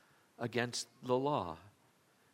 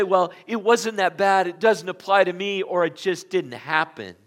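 dismissive excuses; 4 semitones higher, 10 dB louder than usual. Retold or imitated. Mocking denial excuses.

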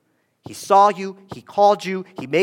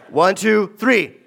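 louder count; 6 semitones higher, 3 dB louder than usual. Real, not acted. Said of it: "A little louder".

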